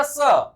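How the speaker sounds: स as long "suh" for sir; r dropped. Shown as a demonstration. Teacher demonstrating "sir" without r, drawn-out स. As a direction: stretch स, leave off the r. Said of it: "सर ना कह करके सिर्फ स".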